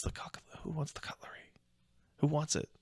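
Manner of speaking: hushed conspiratorial whisper offering loot; secretive whispered offer; mock whisper. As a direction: whisper it like passing contraband. Imitating friend offering stolen cutlery.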